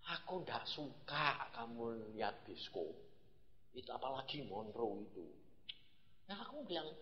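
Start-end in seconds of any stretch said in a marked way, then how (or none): 0.1-5.2 hushed voice of God. Retold or imitated. Preacher voicing God softly to himself. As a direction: quietly voice God's disapproval.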